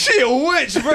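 high-pitched mock accuser's cry; mock witch accusation. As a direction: cry it out like a witch hunter, joking. Acting out a witch-trial accusation.

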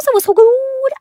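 thrilled high-pitched gush; gushing squeal. Real, not acted. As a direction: squeal it like you're in love with the room. Gushing about her room.

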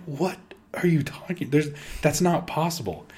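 mock shock, hushed; disbelieving whisper. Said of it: "I'm like".

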